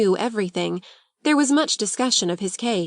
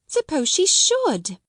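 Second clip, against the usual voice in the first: wondering; 8 semitones higher, slower than usual. Acted.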